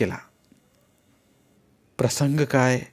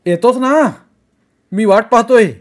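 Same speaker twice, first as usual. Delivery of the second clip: loud and pushy, no patience; loud impatient boss; 9 semitones higher, 9 dB louder than usual. A narrator's reading. Kumar pressing Vaibhav on the phone, loud and impatient. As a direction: cut him off impatiently. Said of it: "Kumar म्हणाला".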